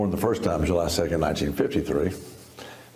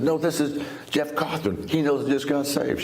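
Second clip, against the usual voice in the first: a man stunned that his friend already heard; excited disbelief; 8 semitones higher than usual. Retold or imitated. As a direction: voice jumping high with amazement. Retelling his amazed phone moment.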